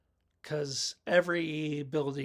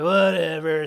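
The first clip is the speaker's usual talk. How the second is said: mock dismissive whatever; 11 dB louder, slower than usual. Voicing the stoner's dismissive comeback.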